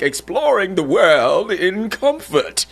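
slow, far-off mock posh ad voice; hammed-up luxury commercial voiceover; overdone posh accent. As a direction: imitate an over-the-top upscale cruise ad with a fancy accent. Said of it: "I mean, it's like overboard".

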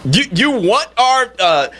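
radio host railing in disbelief at a fake identity; incredulous outrage. Real, not acted. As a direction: pitch it up in disbelief at the absurdity. Incredulous rant at the impostor.

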